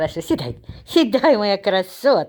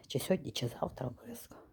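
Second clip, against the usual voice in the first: whispered aside; 6 semitones lower, 17 dB quieter, faster than usual. Real, not acted. Aside about grandma's haircut.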